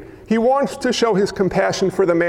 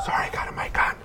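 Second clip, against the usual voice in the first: soft, whispered aside; hushed apology; 8 dB quieter, faster than usual. Real, not acted. Preacher apologizing after screaming into the mic, hushed whisper. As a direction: whisper a quick sorry.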